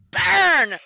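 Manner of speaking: loud, deep dragon-like shout of Burn; deep draconic roar. Acted. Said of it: "in a quite deep voice compared to her normal one".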